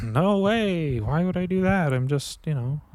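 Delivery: high, lightly put-on defensive voice; mock innocent self. Quoting his own reply to Kylie, mock innocent. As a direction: play it innocent and caught. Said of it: "And I was like".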